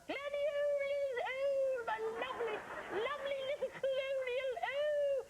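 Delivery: very high, drawn-out British falsetto; Dame Edna. Acted. Host showing off a bad British accent, piercing Dame Edna falsetto. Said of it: "my British accent sounds like Dame Edna".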